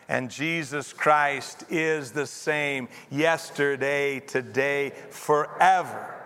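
slow recitation ending on a punched "forever"; measured group reading, "forever" drawn out and strong; stress on FOREVER. Shown as a demonstration. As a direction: save the weight for the final "forever". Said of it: "put the emphasis on a different word".

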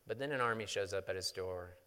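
ordinary talk, nothing marked

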